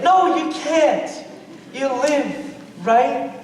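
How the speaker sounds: ordinary talk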